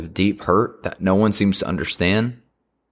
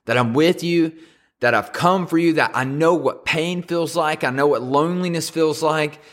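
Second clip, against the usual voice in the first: high, insistent reassurance; God's urgent message, pitched up; mild acted crying out; 6 semitones higher than usual. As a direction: call it out high without shouting. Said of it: "it's God screaming out to us".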